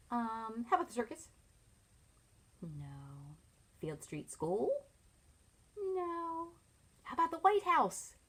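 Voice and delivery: ordinary delivery, nothing marked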